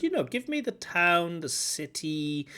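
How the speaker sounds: ordinary delivery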